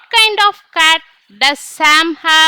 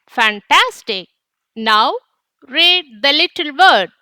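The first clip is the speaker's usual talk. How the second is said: normal talking voice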